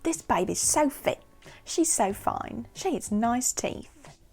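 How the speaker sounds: hammed-up British reality show contestant gushing; loud mock British accent, gushing; mock British reality star. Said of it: "they're always like".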